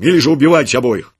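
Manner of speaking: loud, harsh commands; harsh order. A narrator's reading. Legionary ordering his soldiers to kill, loud and harsh. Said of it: "приказал он солдатам".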